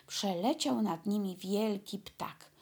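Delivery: usual speaking voice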